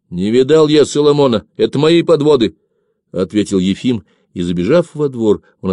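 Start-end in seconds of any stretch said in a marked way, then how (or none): 0.1-2.6 high, loud denial; insistent, louder reply; raised retort. A narrator's reading. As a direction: answer back firmly and impatiently. Arguing back amid hurried loading.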